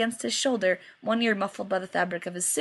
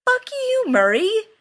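much higher, loud blurted curse; shocked curse yelled at Chad; horrified outburst; 13 semitones higher, 7 dB louder, slower than usual. Acted. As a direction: explode at Chad in horror. Jensen blurting in horror.

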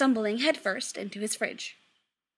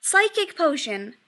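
loud, slower, emphatic correction; exclaiming; 6 semitones higher, 7 dB louder than usual. A narrator's reading. Son correcting his mother about the potion, exclaiming. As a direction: exclaim it, spelling it out slowly. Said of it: "Chris exclaims".

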